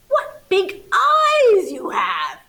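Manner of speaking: slow, high and loud; little girl marvelling, slow and loud; wide-eyed amazement. Acted. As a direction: stretch out each word in awe. Red amazed at grandma's eyes.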